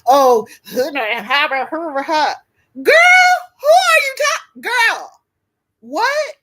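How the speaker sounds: high, loud mock street come-on; hammed-up imitation of a man hollering at a woman; man calling out. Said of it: "if you don't speak to them".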